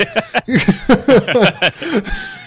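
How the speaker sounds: loud, high laughing fit; burst of laughter. Real, not acted. Cohost laughing at his own draft rankings, helpless laughter. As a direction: crack up laughing at yourself.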